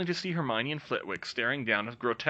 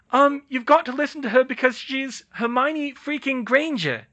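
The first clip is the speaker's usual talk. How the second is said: put-on high, raised girl voice; voicing Nadia; 13 semitones higher, 8 dB louder than usual. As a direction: insist to the class.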